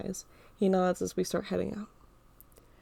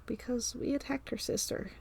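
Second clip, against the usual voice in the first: soft mumble; 4 semitones higher, 6 dB quieter than usual. Acted. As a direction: mutter it under your breath, half-ashamed. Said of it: "he mumbled softly".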